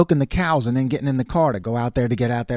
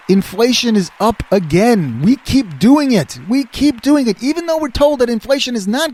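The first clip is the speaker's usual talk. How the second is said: exasperated rant; 8 semitones higher, 5 dB louder than usual. Real, not acted. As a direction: hammer each word in frustration. Ranting about inflation.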